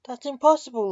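loud, high incredulous laugh-through; laughing disbelief. A narrator's reading. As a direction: scoff it off as a joke. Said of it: "laughed the homeowner".

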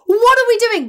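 high, loud giggling disbelief; incredulous squeal. Retold or imitated. Terry before going on air, sweet swapped between mouths, squealing in disbelief. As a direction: squeal it like you can't believe the mischief. Terry quoted, laughing at prank.

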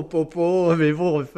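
high, loud playful po po; playful exclamation. Real, not acted. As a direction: toss off a laughing po po. Echoes the po po po with a laugh. (exclamation joueuse et rieuse)

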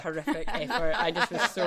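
laughing loudly through the words; words breaking into laughter. Real, not acted. Laughing at her own doll.

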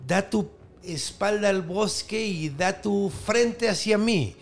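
slow chanted spell; incantation. Acted. Hero reciting the spell to the hut, slow chant. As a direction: recite the magic words slowly.

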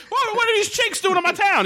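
put-on high, cranky old codger voice; old prospector. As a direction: squawk it like a grumpy old miner. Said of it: "like, old prospector".

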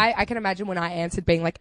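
normal talking voice

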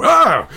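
loud, high shriek of fright; startled scream. Retold or imitated. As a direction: yelp as if someone appears beside you. Driver startled by hidden passenger.

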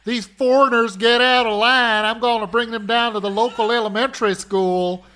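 put-on loud Southern good old boy. Cohost plays gun-toting Tennessee redneck.

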